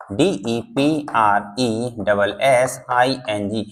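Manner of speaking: letters drawn out one by one for dictation; slow spelling. Shown as a demonstration. Teacher dictating to students, very slow letter by letter. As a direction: spell डीईपीआरई डबल एस आई एन जी letter by letter, very slowly. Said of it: "मैं देखो spelling बोल भी रहा हूँ".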